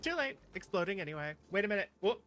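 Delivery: ordinary talk, nothing marked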